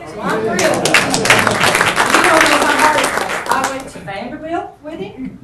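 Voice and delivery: loud burst of exasperation, laughing into it; exasperated outburst. Real, not acted. Mother telling the crowd about recruiting, loud exasperation. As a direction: blurt it out, half laughing.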